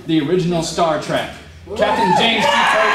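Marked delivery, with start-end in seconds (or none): 1.8-2.9 loud, high cry of fan pride; excited shout. Real, not acted. Speaker admits loving Star Trek to the audience, loud excited shout. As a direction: belt the name out like a proud fan.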